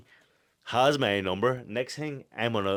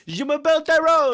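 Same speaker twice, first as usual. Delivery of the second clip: high, loud, hammed-up French accent; mock French; 8 dB louder than usual. Boxer mocking the French speakers on the call in a hammed-up French accent. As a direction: put on a silly French accent. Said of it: "I said".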